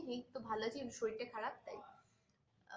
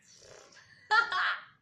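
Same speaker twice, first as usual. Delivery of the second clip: high, loud real laugh; laughing out loud at the comment; burst of laughter; 11 semitones higher, 13 dB louder than usual. Real, not acted. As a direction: break into loud giggling. Laughs at kiss request.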